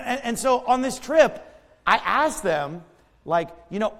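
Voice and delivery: ordinary delivery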